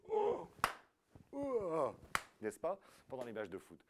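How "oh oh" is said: high, loud imitated crowd "oh"; football fans' "oh" shout; Icelandic viking chant. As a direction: mimic the stadium thunderclap chant. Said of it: "qui font des".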